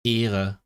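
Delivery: EHRE said in German with an unrolled R; German R example. Shown as a demonstration. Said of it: "usually they have the r".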